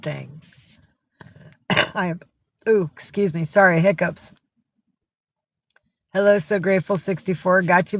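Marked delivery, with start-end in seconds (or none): none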